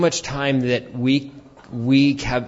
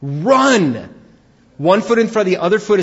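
loud, raised call to run; shouted command; 9 semitones higher, 7 dB louder than usual. Real, not acted. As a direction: urge them out with one word. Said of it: "You're not gonna ease".

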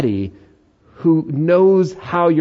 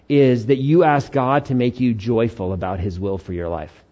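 ordinary talk, nothing marked

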